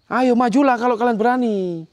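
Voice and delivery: high, loud dare to the ghosts; challenging shout. Real, not acted. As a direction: yell the challenge upward. Challenging ghosts at haunted statue. (teriakan menantang)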